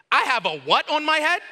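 high-pitched incredulous question; mock disbelief. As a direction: act out being taken aback. Acted disbelief at bounty.

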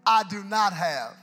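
loud, slow, high proclamation; booming apostle line; Peter proclaiming. Retold or imitated. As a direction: declare it like an apostle to a beggar. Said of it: "Peter goes".

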